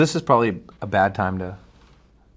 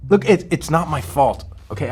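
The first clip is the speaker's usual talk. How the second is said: raised, louder, stammered self-defence; defensive protest; 6 semitones higher, 4 dB louder than usual. Acted. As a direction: push back, higher and louder. Defends himself over late results.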